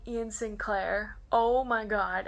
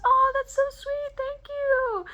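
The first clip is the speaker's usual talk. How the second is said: high, loud, cooing thanks; sweet sing-out, pitched way up; gushing thanks; 6 dB louder, slower than usual. Retold or imitated. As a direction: imitate a touched, high-pitched gush. Voice actor's sweet thanks retold.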